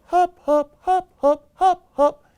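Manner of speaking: high, bouncy staccato hops; hopping rabbit. Acted. Another animal comes up, high hopping rhythm. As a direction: pop each hop out high and evenly.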